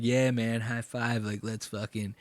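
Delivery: soft, low-key buddy voice; hushed, ingratiating mock plea; imitating Sam. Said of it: "He's like".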